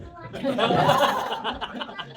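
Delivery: big high laugh breaking out; loud burst of laughter. Real, not acted. Laughing at the journalist's hug request, loud and high.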